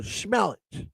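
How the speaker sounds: hushed mock-seductive sales line; greasy salesman charm. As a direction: coax the ladies quietly, imitating him. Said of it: "That's what, how he talked".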